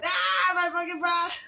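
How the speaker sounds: high, hammed-up wail of a mortified daughter; high mock cry over her bras; mock daughter shriek. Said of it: "That girl was like".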